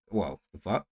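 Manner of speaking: a sudden hushed double take; startled. Real, not acted. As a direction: caught off guard, muttering under the breath. Startled reaction mid-sentence.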